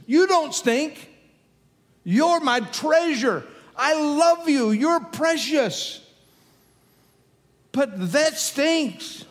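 put-on high, slow, sing-song coo to a little child; parent to toddler. Parent cooing to a fussy toddler, high and slow.

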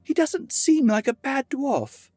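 put-on sweet little girl. A narrator's reading. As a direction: say it innocently, like a small girl. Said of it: "The Kavinna's sweet little voice".

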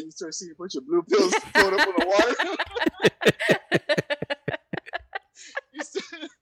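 giggling through the words; laughing hard mid-sentence. Real, not acted. Laughing while telling the story.

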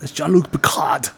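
put-on slow, botched accent that lands nearer Schwarzenegger; badly faked, drawn-out actor impression; Patrick Stewart. Podcaster hyping the Picard trailer, botched Patrick Stewart voice. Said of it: "Why do you sound like Arnold Schwarzenegger?".

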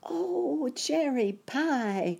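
high, drawn-out delighted exclamation; excited cry. A narrator's reading. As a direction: squeal it slowly with delight. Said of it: "cried Sammy".

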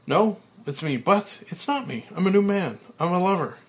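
high, loud elated declaration; bubbling over with new life; giddy joy. Acted. Frank giddy, reformed.

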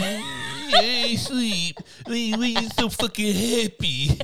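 high, drawn-out mocking voice of the partner; mocking Fox. Mimicking Fox's late-night complaint, high and slow. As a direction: whine it back high and very slow, mocking her.

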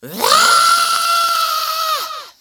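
long high loud shriek; mock scream. Comic reliving mom's midnight scream. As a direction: let out a sustained scream.

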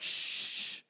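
long unvoiced "shh" to hush someone; imitated shushing. Crowd silencing the blind man, mock shushing. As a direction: shush him like an embarrassed onlooker.

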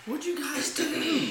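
very high, drawn-out question; mock dismayed squeal. Game master as late Killian finding everyone dead, high mock dismay. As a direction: squeak it out slowly.

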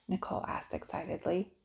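usual speaking voice

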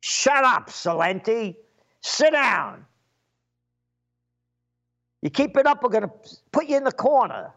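high, sharp mock scolding; mock teacher telling him to shut up and sit down. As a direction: bark like a teacher silencing a student. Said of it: "That's what the teacher would say to you".

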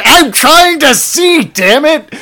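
high, drawn-out frustrated yell; exasperated mock shout. Player yelling back at the telepath, comically exasperated. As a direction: shout it at the intruder, half laughing.

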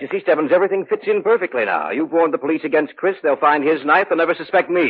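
triumphant villain gloat. Acted. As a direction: lay out the scheme with relish. Killer reveals his plan to victim.

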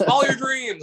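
high, sing-song platitude; hammed-up inspirational cliché; mock advice. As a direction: parody a cheesy pep talk. Mocking Dave Coulier's advice.

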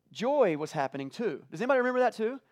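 ordinary talk

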